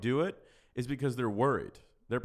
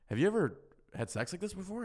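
proud excited question; 8 semitones higher, faster than usual. Retold or imitated. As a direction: pitch jumping high, thrilled with himself. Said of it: "I was like".

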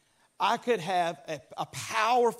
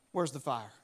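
unbothered shrug; 9 semitones lower, 5 dB quieter than usual. Retold or imitated. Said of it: "I want you to go".

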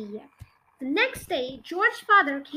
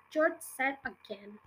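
ordinary delivery, nothing marked

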